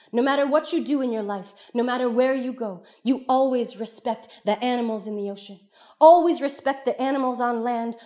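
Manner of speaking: lightly voiced earnest mother; mother teaching. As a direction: impress the rule on a child. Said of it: "the mother comes back and she says".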